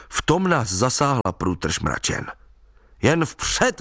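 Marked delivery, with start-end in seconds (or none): 3.0-3.8 high, merry rallying call; cheerily urging on, pitch lifted; cheerful call. A narrator's reading. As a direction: call out brightly to rally them. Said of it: "volal jsem vesele".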